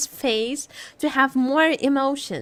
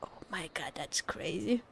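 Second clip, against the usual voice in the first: soft unvoiced gasp of awe, much softer; awed whisper; 6 semitones lower, 13 dB quieter than usual. Real, not acted. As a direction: whisper it in shock. Awed by the dark lore.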